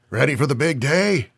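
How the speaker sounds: put-on high, coughing old man with Chicago accent; sick old man, Chicago accent. A narrator's reading. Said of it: "a cough talking with a heavy Chicago accent".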